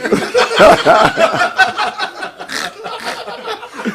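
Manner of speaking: long, high, loud laugh; big laugh. Real, not acted. Laughing at courtroom scene.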